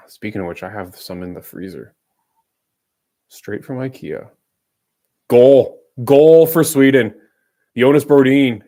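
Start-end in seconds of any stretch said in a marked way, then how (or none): 5.3-8.6 loud goal call, drawn out. Real, not acted. Calling a goal.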